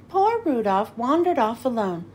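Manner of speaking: ordinary delivery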